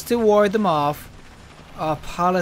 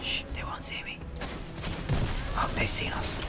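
hushed sneaking whisper; playful whisper; 11 dB quieter than usual. Pretend. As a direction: whisper so the enemy won't hear. Sneaking past enemies.

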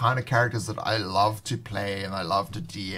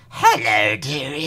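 put-on sweet little old lady greeting; a hint of a kindly grandma; 5 dB louder than usual. Shown as a demonstration. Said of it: "you have to do the old lady voice".

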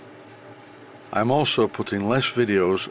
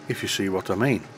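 normal talking voice

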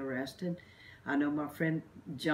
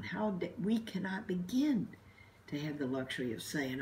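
usual speaking voice

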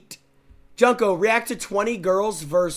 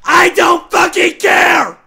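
screaming, much louder and higher; furious shout; 6 semitones higher, 10 dB louder than usual. Real, not acted. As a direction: blow up at him in real rage. Raging at an annoying teammate.

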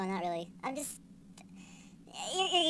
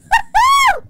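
very high, loud whoop; whooping cheer; 15 dB louder than usual. Real, not acted. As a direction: let out a shrieking cheer. Cheering during wild game play.

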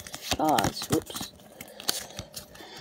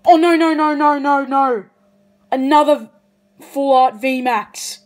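excited disbelief; 3 semitones higher, 8 dB louder than usual. Real, not acted. Collector seeing a second rare card, loud excited disbelief. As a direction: blurt it out in real shock.

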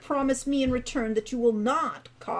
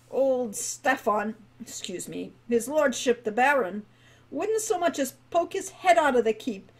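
lightly voiced old dwarf, rising louder as he rambles; old guildmaster working himself up about ruin; rambling old dwarf; 5 dB louder than usual. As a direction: ramble on like an old man lost in memories.

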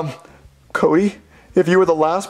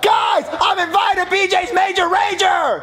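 very high, elated announcement; overjoyed, pitched way up; thrilled shout; 10 semitones higher than usual. Acted. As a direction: cry out as if you won a prize. Gets the coveted invite.

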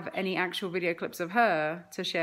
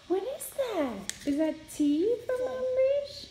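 high gasping wonder; mock surprise; 14 semitones higher than usual. Real, not acted. Gasps at toddler's pretend tea.

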